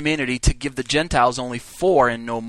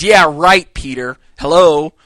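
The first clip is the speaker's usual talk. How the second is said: sarcastic retort; 8 dB louder, slower than usual. Retold or imitated. Mocking retort to Peter.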